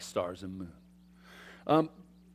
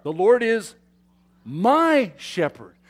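slow, louder, leaning on "my" in "my shepherd"; stress on my; 4 semitones higher, 7 dB louder, slower than usual. Shown as a demonstration. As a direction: proclaim it slowly, weight on "my". Quoting Psalm twenty-three aloud.

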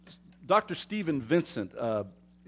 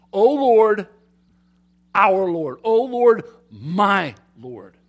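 louder ringing cry of worship; proclaiming praise; 3 semitones higher, 10 dB louder, slower than usual. Acted. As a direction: call it out to the whole room. Preacher proclaiming the psalm's cry.

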